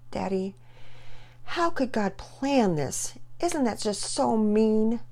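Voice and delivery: put-on high, slow worried little girl; genuinely concerned. Daughter asking her father about God's plan, worried and high. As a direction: ask it with real worry. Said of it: "genuinely concerned".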